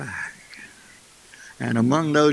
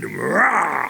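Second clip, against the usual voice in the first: long open roar rising and falling; mock lion roar. Storyteller plays lion landing among the goats, roaring.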